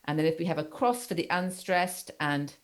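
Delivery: ordinary delivery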